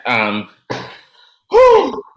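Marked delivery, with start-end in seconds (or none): 1.5-2.0 sharp high exhale; high whew. Real, not acted. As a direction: blow out a loud whew. Exhale before heavy topic.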